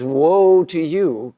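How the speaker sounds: slow, weighty warning with WOE drawn out; a loving but stern rebuke; slow solemn woe. Shown as a demonstration. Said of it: "Jesus is just as loving in today's gospel when he says".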